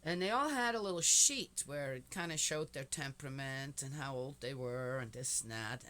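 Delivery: usual speaking voice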